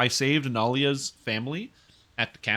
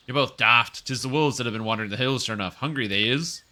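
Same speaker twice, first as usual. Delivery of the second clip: a hint of a rural peasant accent, louder; rustic accent; 4 dB louder than usual. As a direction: scoff at the others in a lightly put-on country accent. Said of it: "I don't have a good accent, but I'm trying my best".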